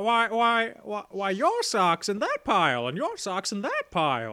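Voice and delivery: stammering, swooping old film-star impression; Jimmy Stewart. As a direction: stutter the opening, then swing the pitch up and down. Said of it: "I'm picturing that voice".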